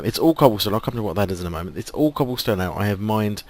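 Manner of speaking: usual speaking voice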